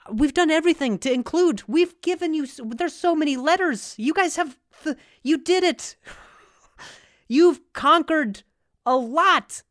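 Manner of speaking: high, loud, worked-up protest; exasperated rant. Real, not acted. Comic protesting that everything was done to include, exasperated. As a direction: raise pitch and volume in exasperation.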